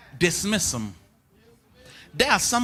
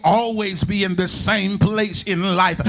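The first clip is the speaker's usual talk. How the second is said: impassioned, driving preacher cadence, pitched up; rolling, half-sung sermon climax; full-force preaching; 6 semitones higher than usual. Real, not acted. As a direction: preach it in a relentless, chanting build.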